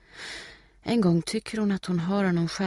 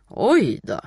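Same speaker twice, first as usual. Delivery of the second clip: mock dismay; 3 semitones higher, 7 dB louder than usual. A narrator's reading. Boy taunting Steffi after her cry, mock dismay. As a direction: tease with a loud, raised exclamation.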